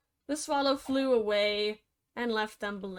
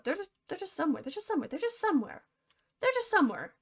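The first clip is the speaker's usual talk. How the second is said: rising, higher and higher repetition; mock lament; 6 semitones higher, much faster than usual. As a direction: climb each repeat up in pitch. Rising repeated mock wail.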